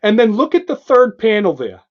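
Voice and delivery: usual speaking voice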